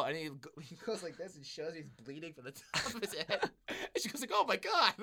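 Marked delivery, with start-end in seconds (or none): 4.3-4.9 shocked. Retold or imitated. Concierge shocked, retold.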